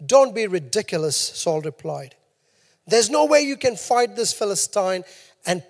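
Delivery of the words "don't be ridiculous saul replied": normal talking voice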